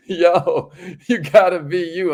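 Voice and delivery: high, loud and cracking up; amused, laughing. Real, not acted. Host tickled by a viewer's joke about spelling, laughing. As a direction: burst out laughing into the words.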